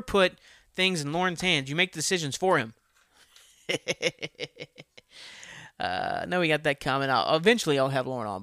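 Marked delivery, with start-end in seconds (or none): 3.7-4.8 real rapid chuckle; laugh. Real, not acted. Speaker laughs about making decisions for Lauren, real chuckle. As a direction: laugh at your own advice.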